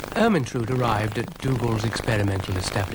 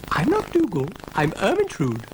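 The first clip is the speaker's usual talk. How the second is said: high, mildly haughty lady voice; rather proud; 8 semitones higher than usual. A narrator's reading. Ermintrude corrects Florence, rather proud high voice. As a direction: announce yourself with a touch of pride. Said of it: "rather proudly".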